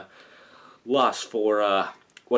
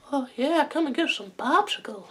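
Herbert the Pervert; 13 semitones higher than usual. Shown as a demonstration. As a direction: lure them in like a creepy old neighbour. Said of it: "doing Herbert the Pervert's voice".

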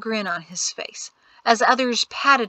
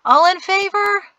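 loud, high call across a crowd; 11 semitones higher, 6 dB louder than usual. A narrator's reading. Magistrate calling the vote to the crowd, loud. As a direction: project the question to thousands.

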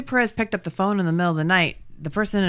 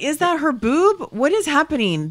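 baffled; 7 semitones higher than usual. Retold or imitated. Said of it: "I was like".

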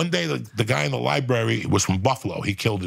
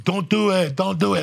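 mock warning call; 9 semitones higher than usual. Repeating warning to inmates.

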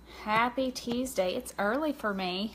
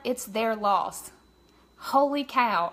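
normal talking voice

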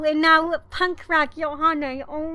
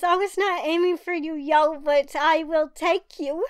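ordinary talk

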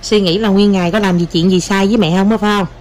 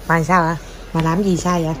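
ordinary talk

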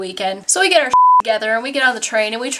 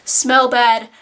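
normal talking voice

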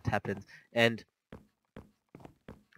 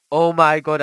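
panicked shout; 5 semitones higher, 14 dB louder than usual. Real, not acted. Monsters close in at night.

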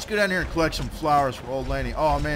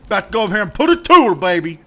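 fast, loud, high rallying shout; pumped-up boast before a fight; hyped-up battle cry; 7 semitones higher, 12 dB louder, much faster than usual. Real, not acted. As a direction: fire it off quickly at full volume. Hyped repeat before boss fight.

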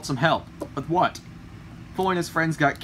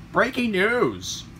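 slow, higher announcer call; radio newsreader; 4 semitones higher, much slower than usual. Acted. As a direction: announce breaking news, stretched out. Radio news bulletin interrupts the scene.

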